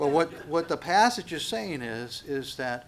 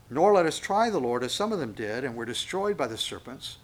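scripture reading; 5 dB louder than usual. Real, not acted. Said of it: "reading scripture aloud".

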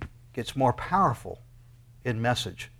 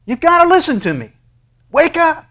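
much higher, loud urgent shouting; 13 semitones higher, 10 dB louder than usual. Acted. Preacher shouting God's wake-up call, high and loud. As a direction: grab them by the collar with your voice. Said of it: "he's grabbing you by the collar".

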